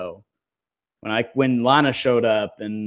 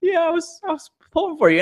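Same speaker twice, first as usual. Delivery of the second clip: much higher, sugary mock nice voice; sweet nice-person voice; faster than usual. Two nice people trading compliments, sugary and much higher. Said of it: "two really nice people".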